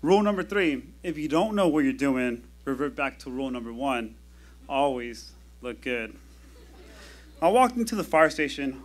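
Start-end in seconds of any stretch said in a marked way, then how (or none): 2.6-6.2 soft repeat. Shown as a demonstration. Paramedic repeats his first rule to the audience, softly. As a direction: hush it as a punchline callback. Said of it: "rule repeated".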